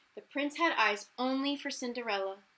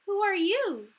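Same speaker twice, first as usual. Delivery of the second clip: high, loud startled question; 9 semitones higher, 7 dB louder than usual. Acted. Cinderella startled by the stranger, high surprise. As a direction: ask it in surprise. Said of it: "said Cinderella".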